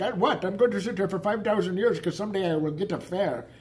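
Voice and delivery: put-on caricatured Indian-accented cab driver; mock Indian accent. Cab driver stuck waiting for a fare, mock Indian accent. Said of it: "Indians who don't speak any English".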